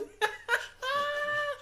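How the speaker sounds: high wailing laugh-sob; shrieking laugh-cry. Retold or imitated. The rant collapsing into a shrieking laugh-cry. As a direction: let the laugh crack into a sob. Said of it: "my G C impression".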